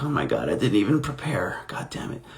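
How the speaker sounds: half-whispered mock dismay; dismayed muttering. Unprepared actor cursing to himself, hushed dismay. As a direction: mutter it under your breath, fading away. Acting out own dismayed inner reaction.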